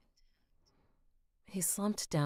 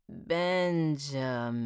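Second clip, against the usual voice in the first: slow, louder, each syllable spelled out; every syllable pronounced; 5 dB louder, much slower than usual. Acted. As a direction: stretch out "Benjamin" syllable by syllable. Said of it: "he pronounced every syllable".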